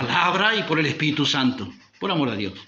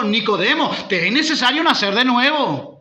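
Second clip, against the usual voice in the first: 1 preaching Jesus' words; 8 semitones higher, 5 dB louder than usual. Acted. Jesus quoted, preacher rising.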